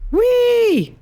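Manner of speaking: high squeal on wee; high siren wee. Shown as a demonstration. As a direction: do wee on request. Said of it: "Now say, "Wee" for me".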